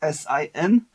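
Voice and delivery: S I N as separate letters; spelled out. Shown as a demonstration. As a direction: say each letter of S I N on its own. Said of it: "we write it as S I N".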